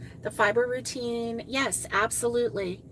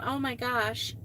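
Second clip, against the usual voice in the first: ordinary talk, nothing marked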